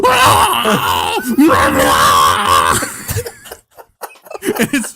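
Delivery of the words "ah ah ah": loud cracking shouted cries; hammed-up pained braying; pained donkey brays. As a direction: bray in agony like a tortured donkey. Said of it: "really pained brays".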